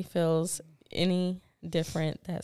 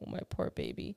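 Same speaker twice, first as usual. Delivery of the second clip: mock pity; 8 dB quieter than usual. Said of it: "And I was like".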